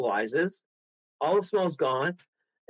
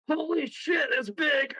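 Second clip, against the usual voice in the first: high startled shout of fright; retold shout; 10 semitones higher, slower than usual. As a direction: yell it out at the sight of the beast. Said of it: "I go".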